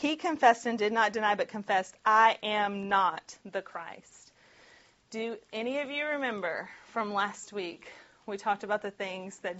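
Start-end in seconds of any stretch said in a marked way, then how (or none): 2.0-4.3 slow, every word weighed; emphatic denial. Acted. John denies being the Messiah, slow and emphatic. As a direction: lean hard on each word of the denial. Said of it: "emphatically saying".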